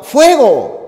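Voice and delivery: sudden fire alarm cry; alarmed shout. Shown as a demonstration. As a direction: yell "¡Fuego!" high and loud in alarm. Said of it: "Con ese tono de exclamación".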